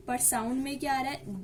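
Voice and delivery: normal talking voice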